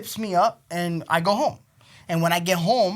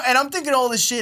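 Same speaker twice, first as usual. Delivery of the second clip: ordinary talk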